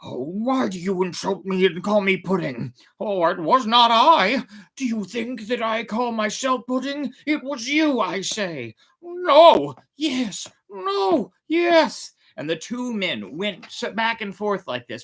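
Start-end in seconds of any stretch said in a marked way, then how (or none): 0.0-8.8 put-on raised, higher old man voice in a fury; furious. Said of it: "he said in a fury". 9.0-12.1 angry quarrel. Acted. Two old men quarrel.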